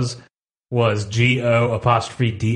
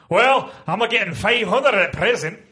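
read-aloud character; 7 semitones higher than usual. Acted. Reading a script character aloud.

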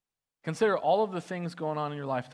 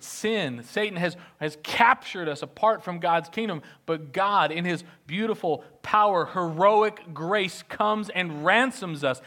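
preaching at full force; 5 semitones higher, 7 dB louder than usual. Acted. Pastor proclaiming the ransom, full-force preaching. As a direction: proclaim the rescue with power.

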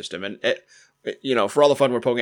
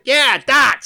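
darts said much higher and louder as tɑts, no R; Boston accent darts; 8 dB louder than usual. Retold or imitated. Student insisting to his English friend, Boston accent. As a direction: bark it out Boston style. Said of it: "old Massachusetts accent".

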